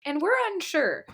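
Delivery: mock nervous, pitched well up; nervous kids. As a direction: imitate kids having second thoughts. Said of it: "are looking a little nervous".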